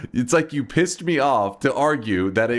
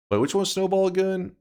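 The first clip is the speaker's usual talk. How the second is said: usual speaking voice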